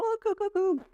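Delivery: put-on high cooing voice for a baby; high sing-song baby talk. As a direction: coo up high like talking to a baby. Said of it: "a sound you'd kinda make at a baby".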